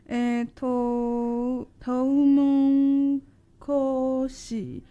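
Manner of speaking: トウモロコシ very slowly, sound by sound; Japanese reading. Shown as a demonstration. Co-host sounding out the Japanese for corn, トウモロコシ drawn out slowly. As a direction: stretch out every syllable of トウモロコシ. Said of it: "我念吗".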